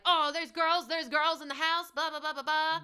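high, loud, over-excited gushing; high-pitched excited babble, trailing into blahs; hyped up. Retold or imitated. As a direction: play a character wildly hyped up. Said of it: "really hyped up".

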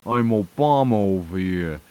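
loud mock Brooklyn accent; badly faked Brooklyn accent, called out loud. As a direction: put on a hammed-up Brooklyn accent. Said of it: "Brooklyn accent".

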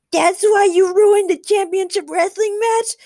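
very high, loud outraged heckle; screaming. A narrator's reading. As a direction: scream it from the crowd. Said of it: "someone screamed".

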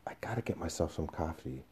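hushed, spent murmur to himself; weak, breath-only self-talk; shaken whisper. Acted. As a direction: whisper it, drained after a fright. Shaken, pressing hands to his eyes.

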